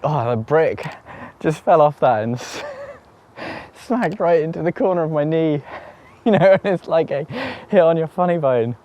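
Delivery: high, strained voice through laughter; in real pain. Real, not acted. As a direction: talk through a throbbing knee, half laughing. Explains knee hit, still hurting.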